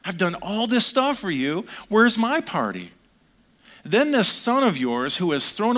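petulant outburst, rising to a near yell; sulky complaint. Acted. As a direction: play the jealous older son demanding his due. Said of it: "can you hear this attitude".

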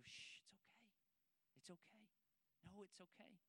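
put-on soft, hushed comforting of a crying child; calm a sick little boy back to sleep; soothing hush. Father hushing crying son.

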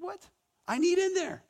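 high squeaky mock outrage at a closed door; mock disbelief.

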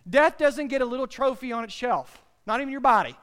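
loud, high, driving sermon climax; loud, high preaching in full flow; full-force preaching. Acted. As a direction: preach it with everything you've got.

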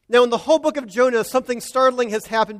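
ordinary talk

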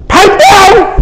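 high-pitched scolding call; acted high holler; mock shout. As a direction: yell it across a crowd, hammed up. Acting out a shout across the crowd.

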